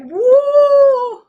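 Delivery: high loud woo; excited whoop. Real, not acted. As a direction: whoop with delight. Excited exclamation.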